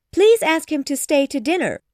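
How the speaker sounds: high, loud, fussing request; flustered excitement at full pitch; eager mother. A narrator's reading. Mother urges daughter to invite him, excited high voice.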